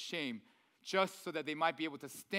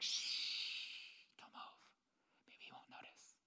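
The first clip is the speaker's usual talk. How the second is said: whispered, hiding under the blankets; a child whispering so dad won't hear; secretive whisper; hushed kid whisper; 13 dB quieter, slower than usual. Pretend.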